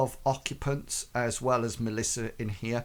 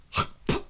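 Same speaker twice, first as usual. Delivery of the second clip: sharp voiceless spitting noise; short mock hawk and spit; imitated spit. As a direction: spit quickly, as into a tankard.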